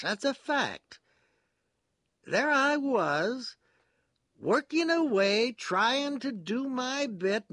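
high, loud, agitated character voice; sententious man confessing with emphatic zeal; earnest confiding prisoner. A narrator's reading.

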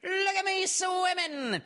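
very high, slow proud little squeak; proud squeaky Roo. A narrator's reading. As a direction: bubble it out, showing off. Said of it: "still bubbling proudly".

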